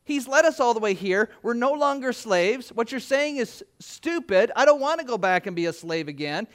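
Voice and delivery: ordinary talk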